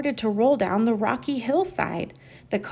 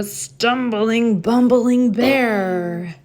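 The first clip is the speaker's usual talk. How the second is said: slow drawn-out bumbling; 5 dB louder, much slower than usual. A narrator's reading. Dramatic read of the bear.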